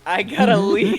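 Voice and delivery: laughing as he leaves. Retold or imitated. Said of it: "you could just hear him laughing".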